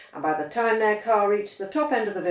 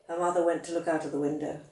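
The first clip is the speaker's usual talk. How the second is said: usual speaking voice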